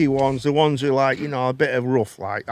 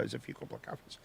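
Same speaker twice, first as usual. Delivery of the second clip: whisper; 7 semitones lower, 23 dB quieter, faster than usual. Real, not acted. Aside while telling story.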